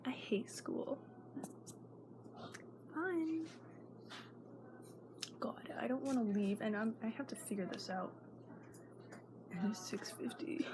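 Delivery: hushed panicky whisper, not waking anyone; anxious whisper. Real, not acted. Girl whispering her panic about packing her bag. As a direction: whisper it fast under your breath.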